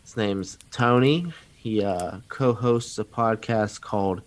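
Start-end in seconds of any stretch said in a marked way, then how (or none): none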